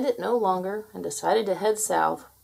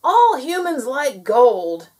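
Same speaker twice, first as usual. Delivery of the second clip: slow, loud, higher gnome shout; 5 semitones higher, 9 dB louder, slower than usual. A narrator's reading. Gnome proposing a plan, shouting. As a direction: announce the idea in a drawn-out shout. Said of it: "he shouted".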